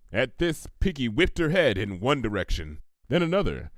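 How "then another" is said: ordinary delivery, nothing marked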